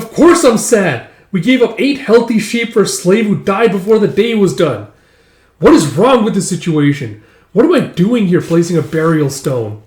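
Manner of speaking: loud, high exasperated rant; screaming and wailing. Retold or imitated. Father angry over eight lost sheep, screaming and wailing. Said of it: "He's just, like, screaming and wailing".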